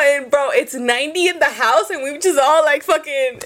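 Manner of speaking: ordinary delivery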